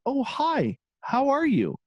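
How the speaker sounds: lifted, bright hi; surprised high greeting. Retold or imitated. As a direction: greet him in a high, startled voice.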